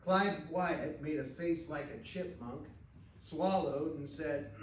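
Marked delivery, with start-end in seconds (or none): none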